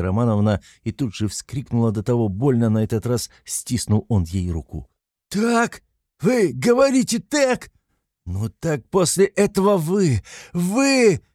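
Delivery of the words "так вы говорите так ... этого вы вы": high, rapturous cry; shouting in rapture; ecstatic shout. A narrator's reading. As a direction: burst out in delight. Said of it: "закричал он в восторге".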